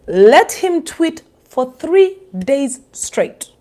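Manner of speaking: ordinary talk, nothing marked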